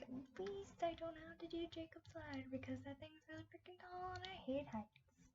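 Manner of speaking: high, soft voice of private thought; high-pitched, hushed self-consolation; retold inner aside. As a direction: think it to yourself in a small high voice. Retold relieved aside to herself.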